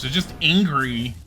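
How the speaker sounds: ordinary talk, nothing marked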